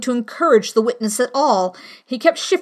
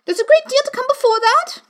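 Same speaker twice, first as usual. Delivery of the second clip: high rabbit voice; 4 dB louder than usual. A narrator's reading. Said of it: "the rabbit hastily interrupted".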